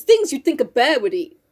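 usual speaking voice

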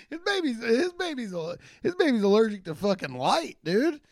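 breaking up with laughter on the words; cracking up while trying to get the line out; laughing through it. Real, not acted. Laughing at his own bit while telling the crowd. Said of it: "cracking up mid-line".

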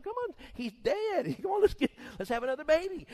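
high, laughing, breezy voice; mock cheerful husband. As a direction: cheerfully nudge the wife to move on. David to Bathsheba, laughing.

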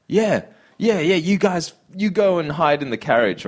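high, fake-friendly brush-off; mock agreeable. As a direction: humour them with fake cheer. Mocking CEO's fake agreement.

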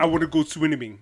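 put-on Russian accent. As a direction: try a Russian accent and fail. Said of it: "I can't do no Russian accent".

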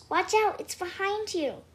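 loud, high warning shout; urgent warning. A narrator's reading. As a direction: call out a sudden warning.

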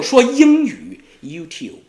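YouTube drawn out slowly with a rolling English lilt; slow sing-song YouTube; exaggerated English. Shown as a demonstration. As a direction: stretch YouTube like a melody. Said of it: "跟着英语的旋律去律动".

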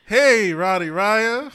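loud, slow, drawn-out hey; loud energetic greeting. Shown as a demonstration. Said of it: "Do that shit again".

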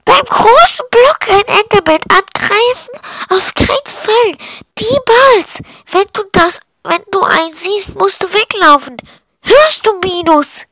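high-pitched put-on voice of outrage; high, fussy, aghast warning; alarmed scolding. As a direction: scold the reckless child in shock. Adult scolds Minus for reckless idea.

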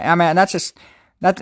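usual speaking voice

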